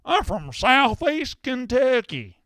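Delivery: very high, slow, drawn-out Kentucky twang; mock Kentucky accent. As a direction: mimic a phony Kentucky accent mockingly. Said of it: "that phony ass bullshit".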